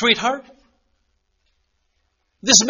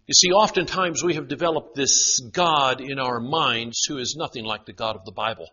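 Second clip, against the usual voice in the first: normal talking voice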